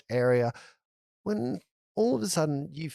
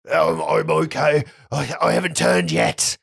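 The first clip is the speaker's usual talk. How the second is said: gruff prisoner voice; 3 semitones higher, 6 dB louder than usual. Acted.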